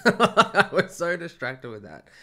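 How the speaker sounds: said through high, loud laughter; slow, laughing confession. Real, not acted. Laughing at her own story.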